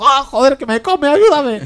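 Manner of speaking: high, slow, loud hammed-up cry for help; mock panicked plea. Gamer voicing wounded NPC begging to be saved. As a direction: wail for help like he's being eaten.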